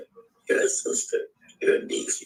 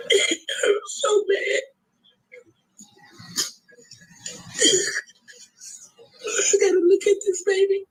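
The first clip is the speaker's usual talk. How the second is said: a grieving mother crying through her words; 8 semitones higher than usual. Real, not acted. Grieving mother pleading with the public, crying. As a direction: voice breaking into sobs, pitched up.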